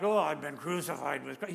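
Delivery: low, sighing mock lament; mock groaning. Preacher mocking a groaning Paul, low and mournful. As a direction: moan it like a reluctant martyr. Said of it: "He's not groaning about the fact".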